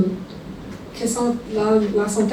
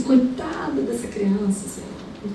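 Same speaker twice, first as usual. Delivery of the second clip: quiet pity; 7 dB quieter, slower than usual. Retold or imitated. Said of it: "e eu tô assim".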